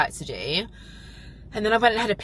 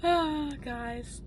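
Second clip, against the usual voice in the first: high soft gasp then sigh; excited gasp; 9 semitones higher, 4 dB quieter than usual. Real, not acted. Wrapping up to her viewers, high excited gasp. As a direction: gasp with delight at the day. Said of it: "what a day".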